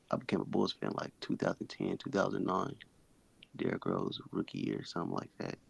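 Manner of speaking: low, faint mumble; barely above a murmur; talking low. Real, not acted. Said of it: "he's talking low".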